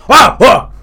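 jolted-awake loud, high outburst; mock startled bellow; Al Pacino. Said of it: "that Al Pacino voice is gonna be like".

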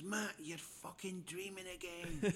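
high, slow exasperated scolding; drawn-out mock annoyance; angry wife. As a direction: imitate a fed-up wife telling him off. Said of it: "she's angry".

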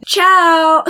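high sing-out goodbye; high, flippant parting call; breezy farewell. Acted. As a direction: toss off a showy farewell. Breezy villain's goodbye, acted.

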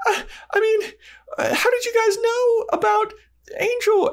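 very high squeaky flustered voice; caught-out Buffy. Retold or imitated. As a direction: squeak it out like a guilty teen.

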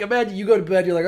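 usual speaking voice

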